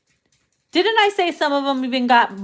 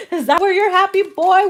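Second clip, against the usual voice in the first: laughing tease; 7 semitones higher, 4 dB louder than usual. Real, not acted. Laughing while teasing.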